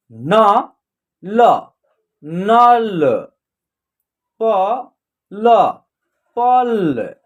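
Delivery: each letter alone, like "न", "ल", then blended "नल"; letter-by-letter sounding out. Shown as a demonstration. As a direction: model "प", "ल", "पल" with long pauses, final vowel dropped in the blend. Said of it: "एक एक अक्षर का उच्चारण करके". ("न", "ल" अलग-अलग, फिर मिलाकर "नल")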